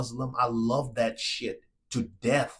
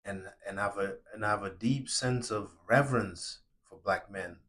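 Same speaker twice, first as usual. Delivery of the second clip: sincere from the soul; 4 semitones lower than usual. Real, not acted. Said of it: "because I'm talking from my soul".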